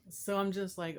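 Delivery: ordinary talk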